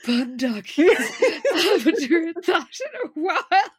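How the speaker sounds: hammed-up wistfulness broken by laughter; mock wistful. Host quoting Liz to co-host, laughing mock wistfulness. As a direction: imitate a wistful line while cracking up. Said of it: "in a really wistful tone".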